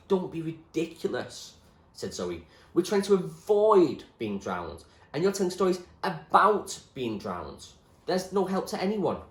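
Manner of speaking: scornful Zoe. Acted. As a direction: dismiss him firmly. Zoe dismisses him.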